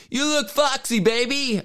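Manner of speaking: high-pitched mock come-on; tame catcall. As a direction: put on a cheesy high catcalling voice. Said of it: "our catcalling was probably much more tame".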